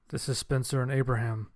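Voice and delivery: ordinary delivery, nothing marked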